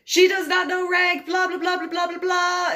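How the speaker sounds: high, loud rattling off of excuses; frantic shouted report. Retold or imitated. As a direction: keep shouting the excuse at the sergeant major. Corporal keeps reporting loudly.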